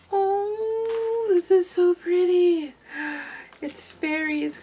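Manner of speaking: high, drawn-out oh of delight; delighted gushing. Real, not acted. Opening a friend's fairy pocket letter, delighted gushing.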